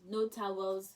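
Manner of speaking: ordinary talk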